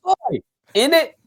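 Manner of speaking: high, loud Cockney quip; mock British accent. Guest keeps up the mock British Cockney accent. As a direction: ham up a London slang line. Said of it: "an accent like [name]".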